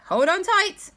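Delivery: high, loud call to hold on; urgent warning. Acted. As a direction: warn them before takeoff. Doctor warning before takeoff.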